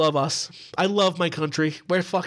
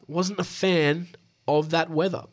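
ordinary delivery

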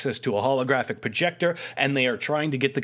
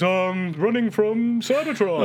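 slow, higher robot drone; Shockwave voice; 8 semitones higher, slower than usual. Retold or imitated. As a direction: draw it out like a robot. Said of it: "And Shockwave says".

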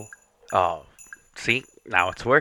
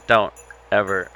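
ordinary delivery, nothing marked

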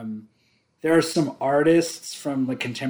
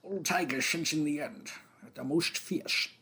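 put-on thick, quiet Scottish accent; 10 dB quieter than usual. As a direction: mumble it in a heavy Scottish accent. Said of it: "Your Scottish accent was too thick".

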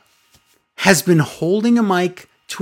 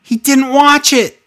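exasperated; 11 semitones higher, 6 dB louder than usual. Real, not acted. Exasperated outburst.

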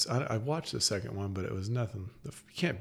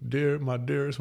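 mock Cage accent; 4 semitones higher, 6 dB louder than usual. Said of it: "He's got a terrible accent".

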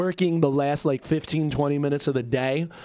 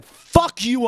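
louder, higher defiant burst; retold angry outburst; 7 semitones higher, 6 dB louder than usual. Worker quitting on his boss, angry outburst. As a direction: re-enact telling the boss off.

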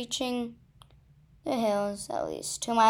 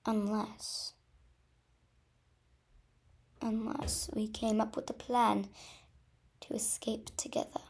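soft, halting suggestion with long pauses; slow, hesitant; 7 dB quieter than usual. A narrator's reading. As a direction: feel your way quietly through the idea. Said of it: "Diamond said slowly".

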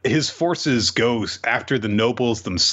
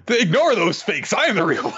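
high-pitched mock plea; pleading illusion; 12 semitones higher than usual. Illusions begging Zeldris for help, high mock pleading.

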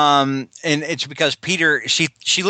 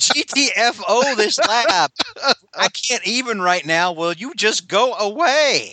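exasperated high-pitched plea, drawn out; mock exasperation, high and slow; Spidey; 8 semitones higher than usual. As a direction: act out a fed-up hero begging someone to go. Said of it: "and Spidey's like".

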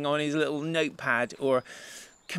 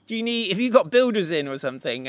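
quick, louder, higher mock query; baffled questioning; 5 semitones higher, 5 dB louder, faster than usual. Guests ask the house owner about builders, mock bafflement. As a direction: act out a baffled guest, lightly.

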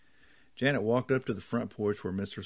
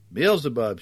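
loud, higher outburst; shouting back; 4 semitones higher, 9 dB louder than usual. A narrator's reading. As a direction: belt the name out at your companion. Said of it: "she shouted back".

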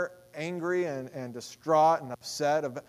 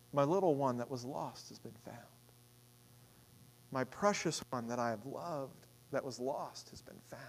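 tender; 4 semitones lower, 9 dB quieter than usual. Acted. God speaking over the found child, soft and tender. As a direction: hold it gently like a child.

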